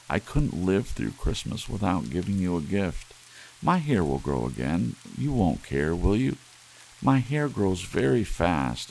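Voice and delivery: put-on a young wife begging her husband not to be angry; pleading young wife. Della pleads with Jim.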